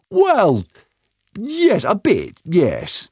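high, drawn-out reluctant admission; hedging. Acted. Doctor admitting to Tomo that tape takes off, hedging. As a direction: squeak out a slow, loud concession.

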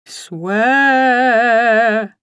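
long mournful spectral wail; sepulchral ghost. A narrator's reading. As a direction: throb with a lost soul's despair. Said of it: "intoned the voice sepulchrally".

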